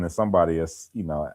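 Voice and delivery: ordinary delivery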